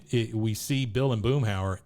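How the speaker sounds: normal talking voice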